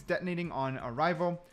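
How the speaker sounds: ordinary talk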